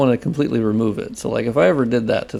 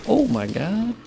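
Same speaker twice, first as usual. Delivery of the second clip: high drawn-out gasps of delight; shrieking jackpot winner; 5 semitones higher, 5 dB quieter, much slower than usual. Retold or imitated. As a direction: squeal it like you just won big. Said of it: "you can go".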